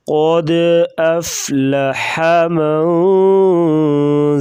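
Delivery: zakaha with hard doubled ک after nasal من; slow chant, pause on the doubled letter; tajweed recitation. Shown as a demonstration. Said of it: "زا کو سختی سے پڑھیں گے".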